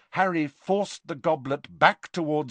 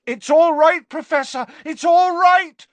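high, loud, urgent reassurance; panicked comforting at full voice; 11 dB louder than usual. A narrator's reading. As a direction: shout comfort over his cries. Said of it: "said Harry loudly".

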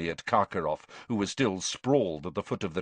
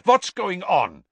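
high, loud agitated demand; cried out; 9 semitones higher, 8 dB louder than usual. A narrator's reading. Karkaroff seeing Krum hurt on the ground, crying out. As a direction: cry out, demanding an explanation. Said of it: "he cried".

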